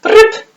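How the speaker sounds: mock pen-flick sound. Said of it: "ceux qui me faisaient prup".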